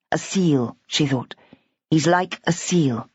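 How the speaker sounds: ordinary delivery, nothing marked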